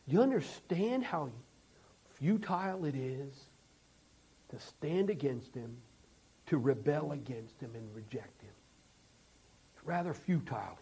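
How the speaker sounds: low, soft solemn preaching with long pauses; grave, quiet sermon voice, spaced out; hushed preaching. Real, not acted. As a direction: drop right down and let each phrase sink in. Preacher drops to hushed solemn delivery.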